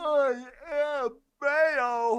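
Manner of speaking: high, loud, drawn-out declaration; mock-epic bellow. Answering whether mayonnaise is an instrument, grand bellow. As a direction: bellow it slowly like a grand proclamation. Bellowing absurd claim over friend's question.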